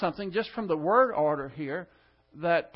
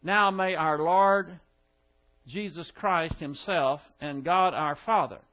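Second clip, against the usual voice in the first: slow, weighty reading with NOW stressed; Now leaned on, then a slow, careful reading; slow scripture reading; 3 dB louder than usual. Shown as a demonstration. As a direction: read it slowly, word by word. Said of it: "So we start out with".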